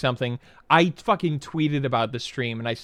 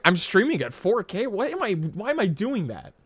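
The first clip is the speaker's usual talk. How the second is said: higher-pitched, incredulous question; retold disbelief; 7 semitones higher than usual. Streamer retelling his reaction to streaming at four K, incredulous. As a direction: replay being baffled, pitch jumping up.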